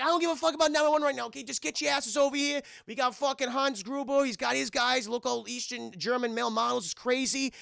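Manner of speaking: high, pushed mock-frantic plea; hammed-up irate caller; exasperated urgency. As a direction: argue heatedly with an unhelpful operator. John argues with police operator.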